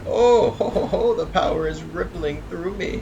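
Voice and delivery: high, loud, slow hammed-up cackle; gloating villain laugh. Hera boasting after eating Zeus, in a high, loud, slow cackling voice. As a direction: ham up a gloating power-drunk cloud. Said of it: "Hera reply".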